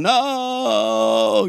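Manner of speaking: a hammed-up high no; dismayed no. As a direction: cry out no as the car door shuts. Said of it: "you're going".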